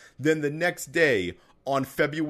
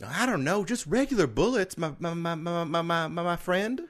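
put-on child voice; 3 semitones higher than usual. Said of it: "sent us a recording".

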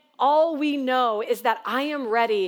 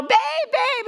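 high, urgent call up the trail; 13 semitones higher than usual. Retold or imitated. Wife calling her husband far ahead, high urgent call. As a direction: call out to him, much higher.

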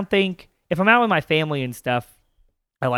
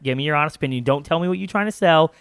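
ordinary delivery

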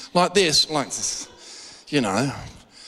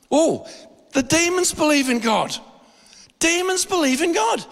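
mock amazement; 9 semitones higher than usual. Real, not acted. Preacher marvels.

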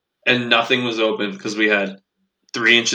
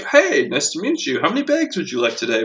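higher-pitched, mock-friendly customer-service voice; polite cashier greeting; 5 semitones higher than usual. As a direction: play an overly pleasant cashier greeting a customer. Models the cashier's proper greeting.

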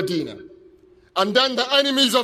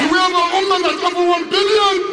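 raised, higher call to the crowd; rallying shout; 6 semitones higher, 4 dB louder than usual. Real, not acted. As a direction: work the crowd with a shouted question.

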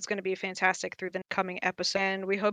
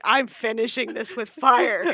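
heated declaration; 5 dB louder than usual. Retold or imitated. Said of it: "I got so heated".

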